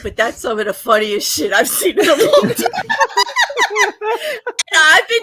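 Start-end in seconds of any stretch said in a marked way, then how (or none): 2.9-4.7 laughing. Real, not acted. Laughing at the video.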